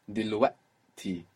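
"دلوقتي" with a throat catch for ق; "دلوقتي" broken by a glottal pause before the final syllable; glottal stop. Shown as a demonstration. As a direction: tighten the throat mid-word in "دلوقتي", then release on ti. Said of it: "Now this little pause is a consonant".

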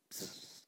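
a hissed psst again and again, barely there; imitating a whispering friend. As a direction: hiss to get his attention without waking her. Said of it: "He's still whispering".

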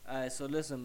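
ordinary delivery

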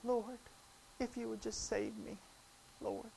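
very soft, meek little plea; hushed, half-hearted request; mock timid prayer. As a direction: pray it faintly, as if afraid to ask. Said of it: "He didn't just say".

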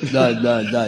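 dopey repeated stammer; tongue-tied. Retold or imitated. Flustered guy trying to talk to a woman, tongue-tied. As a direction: mimic a man unable to get a word out. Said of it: "He's all tongue-tied".

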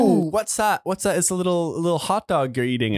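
high-pitched British crowd-work patter; mock British accent. Comic nosing at a diner's hot dog, high British accent. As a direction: imitate an English comic cooing nosily at the audience. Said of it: "You do a little British accent".

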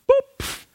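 squeaky high boop sound effect. Retold or imitated. Guide mimics the nudge pushing the climber off, a squeaky high boop. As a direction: pop out a tiny high boop.

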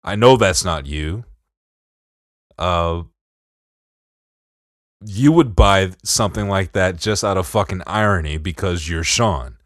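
usual speaking voice

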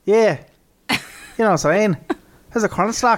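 lightly faked Irish accent; mock Irish accent. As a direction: say stalk with a playful Irish lilt. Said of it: "Why are you Irish?".

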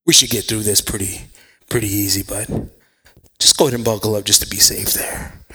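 low, unhurried cockpit reassurance; casual pilot announcement; airline pilot. Acted. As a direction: reassure passengers in a low captain's voice. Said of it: "I sound just like a pilot".

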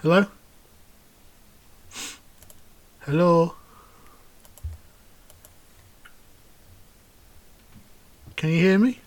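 broken-up voice on a bad call; call dropping out. Real, not acted. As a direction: voice cutting in and out over Skype. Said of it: "All I heard there was this crackling".

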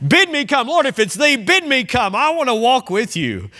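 high, pleading "bid me come"; eager Peter. Retold or imitated. Peter eagerly asking Jesus to call him onto the water, high pleading. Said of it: "But you gotta love that".

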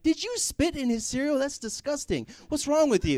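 high-pitched imitation of a disgusted mom; mom scolding. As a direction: scold him like a shocked mother. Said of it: "My mom's like".

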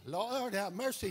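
ordinary talk, nothing marked

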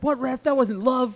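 high, loud mock protest; heckling fan. Fan yelling at the ref over a call, indignant shout. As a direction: shout it up at the umpire.

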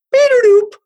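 high, loud device beeps; imitated electronic chime. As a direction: mimic a Bluetooth speaker alert tone. Imitates speaker's disconnect chime.

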